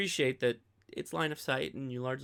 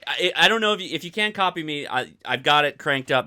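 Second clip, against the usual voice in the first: raised voice so the radio carries; calling over radio; 3 semitones higher, 8 dB louder than usual. Real, not acted. Host calls back to Jerry over a scratchy radio link, raised voice. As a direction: call out loud and clear over the static.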